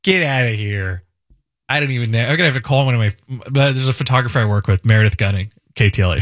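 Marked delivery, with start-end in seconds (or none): 0.0-1.0 put-on Philly accent. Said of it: "can you do a Philly accent".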